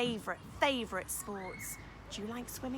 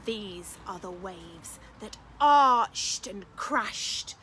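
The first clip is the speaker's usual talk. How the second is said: put-on picture-book reading for children; lively storytime narration; story narration; 7 dB louder, slower than usual. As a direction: read it aloud with wonder for little ones. Reads picture book aloud.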